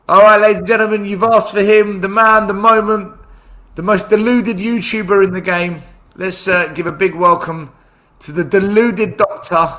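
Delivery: host bigging up a guest arriving on the show, loud announcer style; announcer intro. Pretend. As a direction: hype up the guest like a ring announcer.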